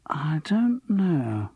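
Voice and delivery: slow, whispered and vague; dreamy, barely voiced whisper. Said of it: "dreamy eyes".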